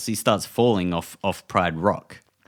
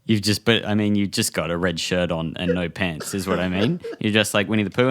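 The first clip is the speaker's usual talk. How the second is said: ordinary talk, nothing marked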